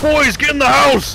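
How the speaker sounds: high, loud order to flee; urgent shout. Acted. Narrator urges boys indoors, urgent shout. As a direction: holler them to safety.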